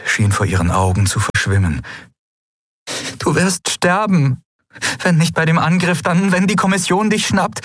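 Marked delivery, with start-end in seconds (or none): 3.2-7.6 high-pitched, anguished warning; distressed warning. A narrator's reading. Endriel warning Telios that he will die, distressed.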